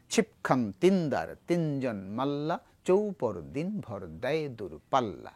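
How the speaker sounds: slow, measured recitation that leans on the beat; rhythmic poem recital. Shown as a demonstration. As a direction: recite the verse slowly, marking each rhythmic step. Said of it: "ছন্দবদ্ধ".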